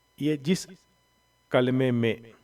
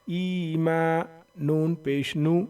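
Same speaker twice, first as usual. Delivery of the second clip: ordinary delivery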